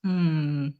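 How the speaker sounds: usual speaking voice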